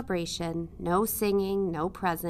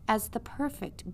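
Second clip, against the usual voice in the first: ordinary delivery, nothing marked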